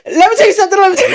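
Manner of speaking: high, loud, laughing outburst; laughing through tears. Real, not acted. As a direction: push the words out over laughter. Hosts break up laughing through tears.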